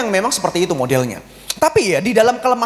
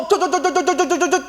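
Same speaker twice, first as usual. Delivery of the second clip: higher clipped chattering syllables; playful gossip patter; rapid chatter imitation; 9 semitones higher than usual. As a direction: mimic a mouth running nonstop.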